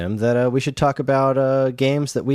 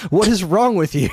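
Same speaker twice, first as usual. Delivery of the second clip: higher, louder appalled cry; loud, high what is wrong with you; appalled outburst; 8 semitones higher, 4 dB louder than usual. Real, not acted. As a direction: react like they just did something gross. Disgusted exclamation at player.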